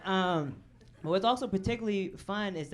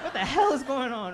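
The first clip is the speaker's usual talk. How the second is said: higher, louder mock-macho demand; masculine indignation; 5 semitones higher, 6 dB louder than usual. Rider standing up on a broken-down bus, mock masculine indignation. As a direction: demand answers with comic bluster. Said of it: "started feeling real masculine".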